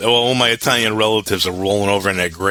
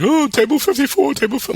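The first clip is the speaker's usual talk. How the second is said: 1 crying server. Retold or imitated.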